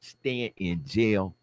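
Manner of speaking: usual speaking voice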